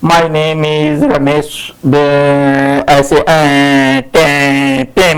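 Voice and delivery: low, slow, hesitant stammer; nervous fumbling intro. Shown as a demonstration. Teacher demos a nervous student's fumbling intro, low and halting. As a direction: mumble it like a scared beginner. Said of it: "don't say introduction like this".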